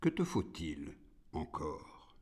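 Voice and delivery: deep, very soft question; quiet, unmoved deity; God's voice. A narrator's reading. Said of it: "Dieu dit".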